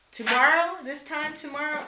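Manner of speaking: almost there, pump yourself up; excited countdown. Retold or imitated. Self-talk countdown, psyching up.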